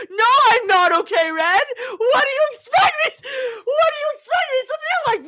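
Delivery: high, wailing protest; frantic high-pitched retort; worked-up outburst. Real, not acted. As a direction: wail it back at them, overwhelmed. Mock outburst answering the chat.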